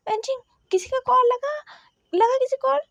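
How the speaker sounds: loud, high, urgent asking; shouted question. Acted. Niohseng shouting to Venching about the call, loud urgent shout. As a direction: shout it across the noise. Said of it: "वेनचिंग से चिल्लाकर कहा".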